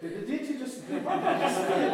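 very high, loud, laughing squeal; hammed-up shock breaking into laughter; startled disbelief. Half-asleep chanter hearing the tape, mock startled.

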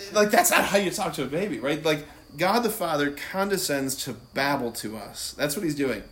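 normal talking voice

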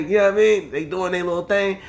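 ordinary talk, nothing marked

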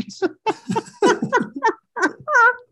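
high, loud helpless cackle; uncontrolled laughing. Real, not acted. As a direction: crack up laughing at a remembered clip. Cracking up at remembered clip.

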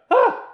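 sharp high shriek of shock; retold shriek. Shrieking as she slams the door on the boys. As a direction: shriek like a startled girl. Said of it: "And she's like".

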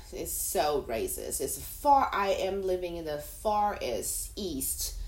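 normal talking voice

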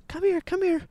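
high squeaky "come here" to a dog; dog-calling voice. Retold or imitated. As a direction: coax a dog in a high sing-song. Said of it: "when I went".

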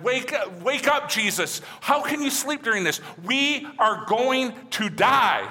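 high, frantic plea to wake up; panicked disciples. Retold or imitated.

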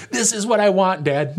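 mock child pleading with dad; whiny kid. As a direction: beg like a stubborn kid. Child begging father in acted scene.

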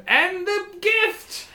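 high, slow proclamation; kobold boss. Acted. Kobold boss names the last item, high announcing. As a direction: draw out the final item in a squeaky voice. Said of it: "and he says".

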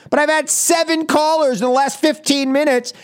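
usual speaking voice

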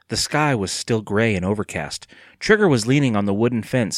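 ordinary delivery, nothing marked

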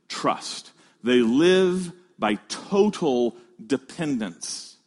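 slow, deliberate preaching with weighted pauses; slow emphasis. Real, not acted. Preacher telling the congregation how children live, slow and emphatic. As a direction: land each word separately. Preacher drives home key point.

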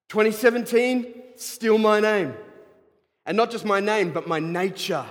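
high, loud declaration; preaching at full force. Real, not acted. Pastor declaring to the congregation, loud and high. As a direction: lift the congregation with it.

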